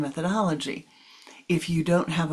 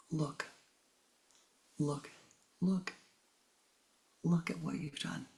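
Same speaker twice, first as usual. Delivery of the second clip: softer, patient "look, look"; gentle quiet pointing; 9 dB quieter than usual. Retold or imitated. Guru quietly showing student his reaction, soft. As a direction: coax it gently, almost hushed.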